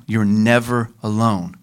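normal talking voice

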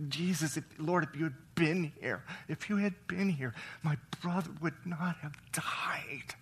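a grieving sister's broken plea, fading to a whisper; filled with emotion. Shown as a demonstration. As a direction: plead through grief, letting the last words fall to a whisper. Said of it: "it was filled with emotion".

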